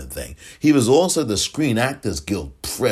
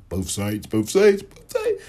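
mocking; 2 semitones higher than usual. Mocking a catchphrase.

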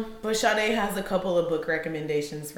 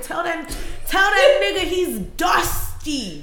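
imitated friend, higher-pitched sass drawn out to the end; friend's cussing-out coaching; 7 semitones higher than usual. Friend coaching her to cuss out catcallers, sassy mock yell. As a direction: egg her on, building to a mock shout. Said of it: "one of my friends was like".

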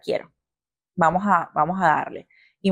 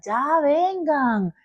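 high, drawn-out call for help; imitated desperate call; 7 semitones higher, much slower than usual. Woman in labor summoning the nurses, drawn-out high call. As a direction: call out to the nurses, stretching the words.

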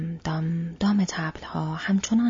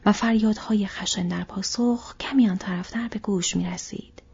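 ordinary delivery

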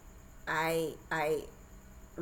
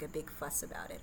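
ordinary delivery

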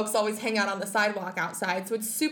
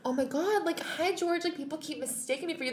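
starstruck friend; 6 semitones higher, 5 dB quieter than usual. Retold or imitated. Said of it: "And Austin's like".

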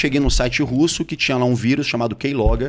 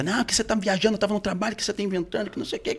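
high, soft mocking imitation; mock her protests in a thin high voice, getting quieter; snooty, defensive partner; 9 semitones higher, 6 dB quieter, faster than usual. Cornered partner denying everything, imitated as snooty and high-pitched. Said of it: "ela já meio que pernóstica".

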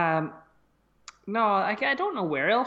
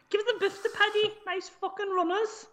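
high, throaty mock Scouse; put-on Scouse, pitched way up; Scouse accent; 11 semitones higher than usual. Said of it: "the back of the throat".

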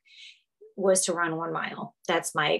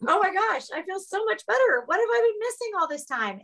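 much higher, gushing and amazed; high, thrilled disbelief speeding up at the end; overjoyed relief; 12 semitones higher than usual. Retold or imitated. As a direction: squeal it out like a revelation.

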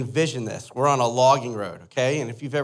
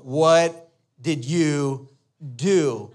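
drawn-out, very slow incredulous question; retold dad's appalled slow-motion question; stunned disbelief; much slower than usual. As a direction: stretch out each word, aghast. Dad sees stuck truck, stunned.

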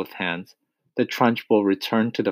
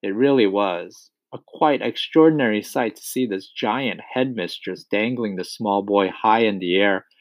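usual speaking voice

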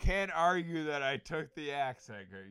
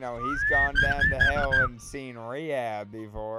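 put-on southern accent; 10 dB louder than usual. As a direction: preach it like a tipsy Southern pastor. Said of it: "You took my western sh-, uh, southern shit".

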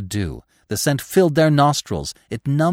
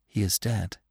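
trembling; 3 semitones lower, 8 dB quieter than usual. A narrator's reading.

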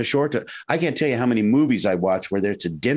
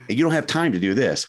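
ordinary talk

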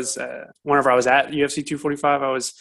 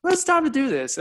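much higher, a hammed-up game-on voice; mock resolve; 13 semitones higher than usual. Gearing up to read Instagram comments, hammed-up resolve. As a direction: announce it like a big mission.

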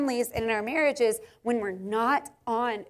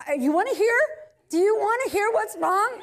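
mock exasperation; 9 semitones higher, 4 dB louder than usual. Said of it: "And he was like".

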